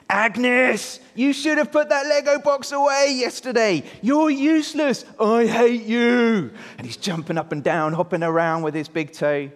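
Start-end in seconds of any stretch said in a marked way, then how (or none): 0.1-6.5 high, loud hammed-up tantrum; angry shout. Andrew to his sister who left out Lego, acted angry shout. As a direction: yell it at your sister in mock fury. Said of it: "he starts to get really angry".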